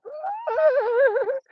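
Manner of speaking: high burst of shouted laughter; loud laugh. Real, not acted. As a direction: let a big laugh burst out. Laughing at chieftaincy titles.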